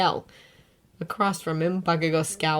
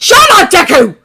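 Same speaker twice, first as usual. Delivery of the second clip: angry; 13 semitones higher, 17 dB louder than usual. A narrator's reading. Bakugo shouting.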